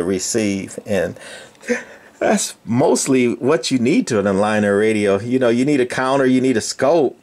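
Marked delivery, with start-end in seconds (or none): none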